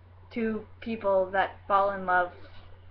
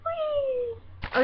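very high, held squeal; sustained high-pitched whee; playful whee. Real, not acted. Vlogger playing about on camera, playful high whee.

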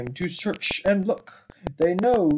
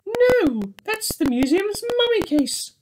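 high bear voice. Acted. Bear corrects the others.

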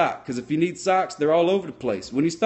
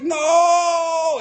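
higher, louder hammed-up "No!"; mock cry; 13 semitones higher, 5 dB louder than usual. Jack crying out to Rose as he goes under, hammed-up. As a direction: yelp it like a drowning man.

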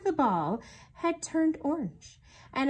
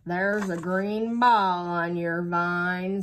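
slow, drawn-out gloomy donkey voice; slow gloomy Eeyore voice, lightly voiced; 6 dB louder, much slower than usual. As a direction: drag each word like a glum old donkey.

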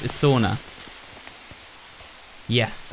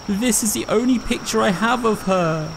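lightly voiced grieving old man, higher pitched; sobbing old man; 9 semitones higher than usual. Matsuno grieving over his granddaughter's picture, lightly voiced sob. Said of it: "and he's sobbing".

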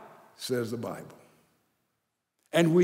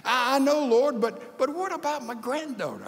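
pleading protest; 9 semitones higher than usual. Pretend. Pleading with God over granddaughter.

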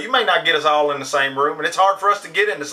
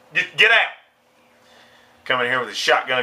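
ordinary delivery

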